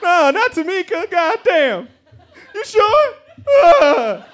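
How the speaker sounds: high-pitched, loud mock wailing; fake crying. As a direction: overact the grief, badly faked sobs and wails. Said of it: "I still had to fake cry".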